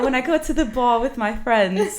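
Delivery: put-on British accent, slightly lifted; chatty British bar persona. Shown as a demonstration. Showing how she talks at bars, British accent. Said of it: "I'll talk like this".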